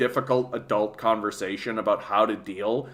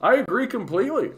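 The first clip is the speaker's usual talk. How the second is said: high, exaggerated eager agreeing; retelling his own overdone agreement; mock eager agreement; 9 semitones higher than usual. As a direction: agree with him in a pitched-up, overdone way. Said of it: "And I'm like".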